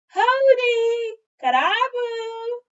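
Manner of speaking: very high, loud hello, drawn slow; loud greeting. Acted. Child greeting Winnie at her hut, loud and drawn out. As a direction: shout a cheery greeting across.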